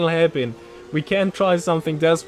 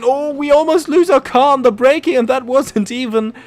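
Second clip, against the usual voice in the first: higher, louder burst of alarm; real rush after a near crash; excited; 7 semitones higher, 6 dB louder than usual. Real, not acted. Near loss of control.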